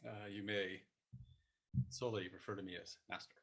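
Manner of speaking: soft, low self-important master; martial arts Master, solemn. Pretend. Guest introducing himself as Master, hushed mock solemnity. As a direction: play a hushed, grave sensei.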